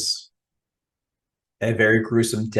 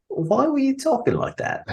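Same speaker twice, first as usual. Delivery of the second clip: much higher squeaky British questioner; mock asker. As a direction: squeak it as a puzzled Brit. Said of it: "it's like".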